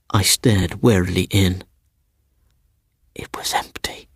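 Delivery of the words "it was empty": breathless whisper. A narrator's reading. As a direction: drop to a whisper for the reveal. Suspense narration.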